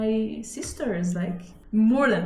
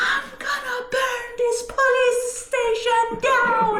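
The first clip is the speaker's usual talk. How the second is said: furious mom; slower than usual. Retold or imitated. Mom storms the police station.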